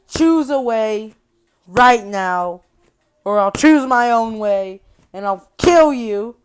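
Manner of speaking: loud, threatening villain order; acted ultimatum. Freddy ordering the girl to choose, loud threat. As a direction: lay down a loud, spaced-out threat.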